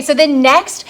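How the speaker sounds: normal talking voice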